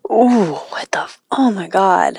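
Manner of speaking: breathy shocked whisper. Real, not acted. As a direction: gasp it out in disbelief. Shocked at broken dick story.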